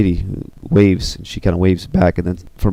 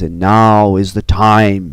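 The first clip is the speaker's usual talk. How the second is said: slow, drawn-out grand declaration; drawn-out villainous announcement; villain's proclamation; 4 dB louder, much slower than usual. Acted. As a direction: proclaim it to your minions, stretching the words. Villain proclaims to his minions.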